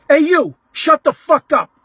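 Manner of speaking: mock angry holler, each word punched out; retold screaming. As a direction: yell it like a ballplayer at a fan. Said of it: "screaming, hollering".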